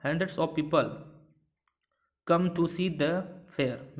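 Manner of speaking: very slow, word by word example reading; slow dictation. Shown as a demonstration. Teacher dictates an example to the class, very slow. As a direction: read the sentence slowly so students follow. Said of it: "teacher reads example".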